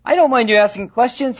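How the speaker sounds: high-pitched, eager fatherly reassurance; enthusiastic. Acted. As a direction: insist brightly, playing the keen dad. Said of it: "the father was very enthusiastic".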